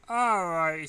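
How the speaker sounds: announcing. A narrator's reading. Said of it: "she said".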